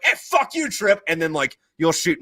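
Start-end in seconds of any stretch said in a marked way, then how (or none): 0.0-1.0 much higher, hammed-up retort at Trip; mock angry reply. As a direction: fire the insult back, cartoonishly. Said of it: "And then you're like".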